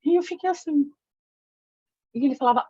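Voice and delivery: ordinary delivery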